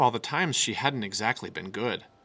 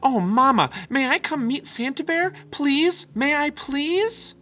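pleading cub; 14 semitones higher, 6 dB louder, slower than usual. A narrator's reading. Little cub begging her mother.

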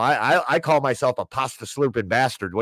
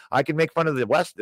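normal talking voice

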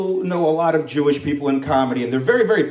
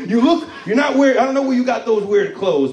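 a hint of a bossy ancient Egyptian overseer, higher and louder; pharaoh's overseer; 8 semitones higher, 4 dB louder than usual. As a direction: play the overseer scolding the stranger.

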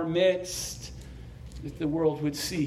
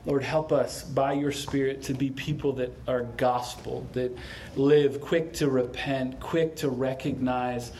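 pastor leading a gentle closing prayer; 4 semitones lower than usual. Acted.

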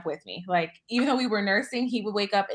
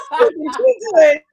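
high, loud laughing repeat; cracking up while repeating it; laughing hard; 11 semitones higher, 12 dB louder than usual. Real, not acted. Retelling husband's line, cracking up.

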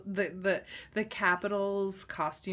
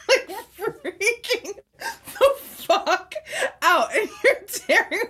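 laughing; 12 semitones higher, 8 dB louder, slower than usual. Real, not acted. Imagining cohost panicking in arena.

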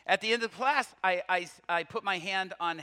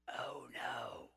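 barely voiced, low whisper; whispered dismay; 8 semitones lower, 12 dB quieter than usual. Classmate touched on the shoulders, dismayed whisper. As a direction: whisper it in dread, imitating her.

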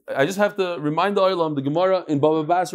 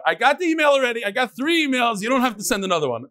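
higher, fast, mock fed-up; mock exasperation; 6 semitones higher, faster than usual. Teacher waving off repeat emails, mock exasperated. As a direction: brush off the emails in jest.

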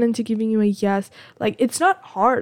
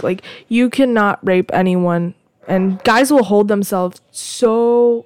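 ordinary delivery

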